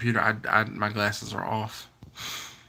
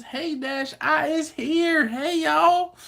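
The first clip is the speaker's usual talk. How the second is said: high, slow put-on Southern drawl; slower than usual. Reading a Canadian viewer's greeting in a joking Southern drawl. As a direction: stretch it out in a hammed-up Southern drawl. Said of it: "I give her a southern drawl".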